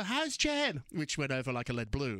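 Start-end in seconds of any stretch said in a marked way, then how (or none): 0.0-0.8 retold scream. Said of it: "I screamed to her".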